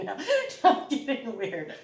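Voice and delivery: amused, laughing self-remark. Real, not acted. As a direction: crack up at your own silliness. Laughing at her own silliness.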